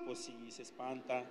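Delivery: ordinary delivery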